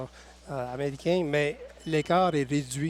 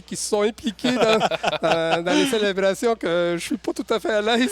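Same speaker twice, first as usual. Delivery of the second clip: laughing; 6 semitones higher, 6 dB louder than usual. Real, not acted. Laughing about Grey Cup celebrations.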